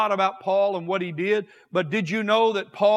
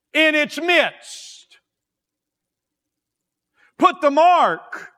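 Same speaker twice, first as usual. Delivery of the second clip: fiery preaching; 4 semitones higher, 5 dB louder than usual. Acted. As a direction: thunder the angel's orders from the pulpit. Preaching God's command, voice rising.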